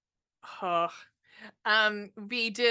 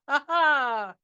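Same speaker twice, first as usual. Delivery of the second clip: a teasing "ha ha", louder and higher; mock taunt; 6 semitones higher, 7 dB louder than usual. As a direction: gloat playfully, as if tricking the audience. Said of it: "I'll be like".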